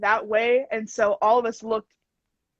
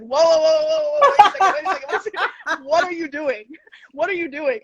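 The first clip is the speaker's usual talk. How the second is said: loud, higher mock stop-the-class call; startled teacher; 6 semitones higher, 4 dB louder, faster than usual. Miss Cabo stopping a student doing the whip, loud exasperated call. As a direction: halt the dancers, exasperated. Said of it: "Miss Cabo was like".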